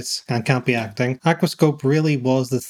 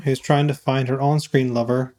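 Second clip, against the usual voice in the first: ordinary delivery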